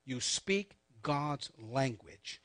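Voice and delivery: word by word, with long gaps; slow emphasis. Shown as a demonstration. Preacher insists on consistency to congregation, slow emphatic delivery. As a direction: draw out "speak God's language" deliberately.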